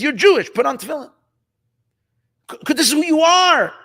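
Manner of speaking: high, loud, emphatic appeal; insistent urging. Retold or imitated. Voices the Rebbe's urgent outreach.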